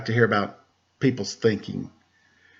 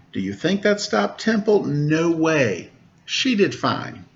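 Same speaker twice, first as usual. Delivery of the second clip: put-on reading to children. Teacher reading a Temple Grandin picture book to kids, lively storybook voice. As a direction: read the rhymes aloud to a class of kids.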